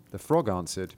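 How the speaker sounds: plain reading voice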